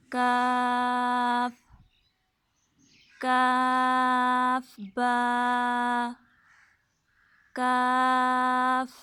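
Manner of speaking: slow, deliberate letter-by-letter recitation; alphabet drill. Shown as a demonstration. Teacher reciting letters to her students, slow and clear. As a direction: call out each letter slowly for the children to repeat.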